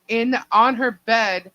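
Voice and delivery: ordinary talk, nothing marked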